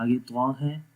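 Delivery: slow, measured recitation on a level pitch; drawn-out, evenly intoned syllables; Quranic spelling drill. Shown as a demonstration. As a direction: chant each letter, vowel and syllable.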